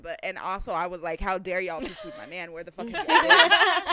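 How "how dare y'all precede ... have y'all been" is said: mock outrage. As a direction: start scolding, then explode into a laughing shout. Acted scolding of the quiet crowd.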